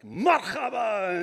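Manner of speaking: high, drawn-out rough Arabic greeting. Shown as a demonstration. Comic showing how rough Arabic sounds, harsh Arabic. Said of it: "Arabic's a really rough language".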